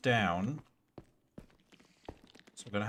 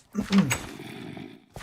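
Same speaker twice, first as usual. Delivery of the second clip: throat clearing; 10 semitones higher, 4 dB louder than usual. Real, not acted.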